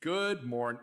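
ordinary talk, nothing marked

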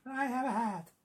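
silly, very high put-on voice; terrible Amanda impression. Joining the teasing about Amanda, terrible impression. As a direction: squeak it as a bad imitation. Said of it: "You do a terrible Amanda impression".

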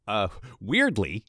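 usual speaking voice

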